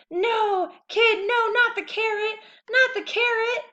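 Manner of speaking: very high, loud pleading; terrified cartoon tooth begging; panicked tooth. A narrator's reading. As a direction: beg for its life.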